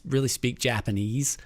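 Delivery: ordinary talk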